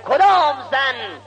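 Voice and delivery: high, loud, drawn-out question; proclaimed riddle. Acted. Scholar posing his next question, proclaimed riddle. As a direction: stretch the words out like a public challenge.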